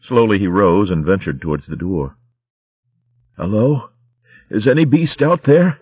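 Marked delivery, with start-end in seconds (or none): 3.4-5.8 higher, louder nervous call. Acted. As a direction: call out through the door. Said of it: "he called".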